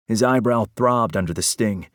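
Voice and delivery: usual speaking voice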